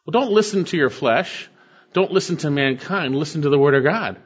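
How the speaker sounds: usual speaking voice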